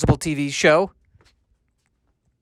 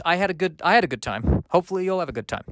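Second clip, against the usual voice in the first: usual speaking voice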